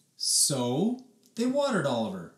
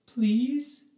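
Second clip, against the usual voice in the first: pleading mom; 10 semitones higher than usual. Acted. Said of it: "said Mom".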